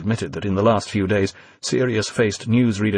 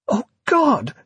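horrified. A narrator's reading. Evie horrified at confession.

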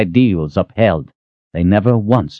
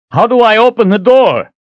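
shouting; 6 dB louder than usual. Acted. Shouting through the door.

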